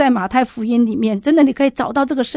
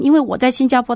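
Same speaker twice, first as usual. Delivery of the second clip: normal talking voice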